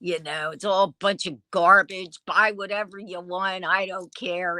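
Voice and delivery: ordinary talk, nothing marked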